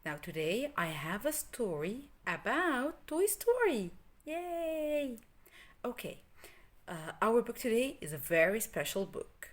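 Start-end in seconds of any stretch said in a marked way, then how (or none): 4.2-5.2 bright high yay; high happy yay; excited cheer. Real, not acted. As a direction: cheer with delight. Child cheer before story.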